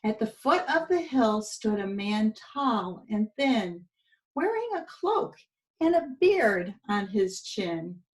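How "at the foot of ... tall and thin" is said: usual speaking voice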